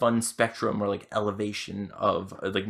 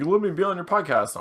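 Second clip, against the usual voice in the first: louder, higher pushy request; pushy guy voice; 9 semitones higher, 6 dB louder than usual. Retold or imitated. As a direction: ham up an eager guy. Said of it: "he's like, he's like".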